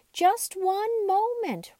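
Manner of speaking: high, slow rag doll voice; soft cottony voice. Acted. Raggedy Ann halting the eager dolls, high and slow. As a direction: stretch it out like a gentle rag doll. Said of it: "spoke in her soft, cottony voice".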